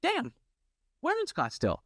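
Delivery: mock surprise. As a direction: act amazed at the lucky coincidence. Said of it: "I said".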